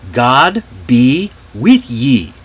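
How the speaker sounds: God be with ye, slow, each word apart; long full phrase. Shown as a demonstration. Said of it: "was a very long sentence".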